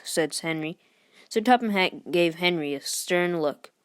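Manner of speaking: plain reading voice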